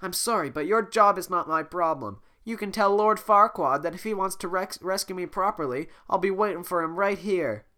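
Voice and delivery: high, loud, haughty refusal; haughty princess. Acted. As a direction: dismiss him like royalty. Voicing Fiona.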